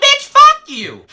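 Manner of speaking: very high, loud, drawn-out comeback; hammed-up squealing retort; indignant shout. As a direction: yell it back in a mock-outraged screech. Retorts to her insult.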